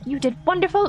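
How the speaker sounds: high, cooing praise; praising a pet. Acted. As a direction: coo to a little creature after patting it. Player praises her mushroom pet.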